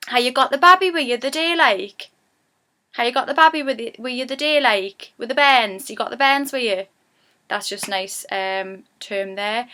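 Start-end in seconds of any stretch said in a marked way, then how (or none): 0.1-6.8 lightly played Geordie friend asking after the baby; chirpy Geordie question, a touch higher; Geordie chat. Shown as a demonstration. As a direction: Geordie woman greeting a friend with her baby. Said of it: "we'd say".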